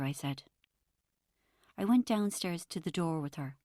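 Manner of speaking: ordinary delivery, nothing marked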